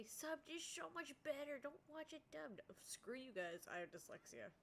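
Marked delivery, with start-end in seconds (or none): 0.0-2.6 higher mocking know-it-all voice; mocking anime fan voice. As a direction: parody a snobby fan. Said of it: "And before you say".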